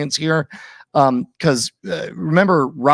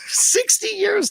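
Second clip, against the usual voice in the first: laughing; slower than usual. Real, not acted. Laughing in disbelief at the joke.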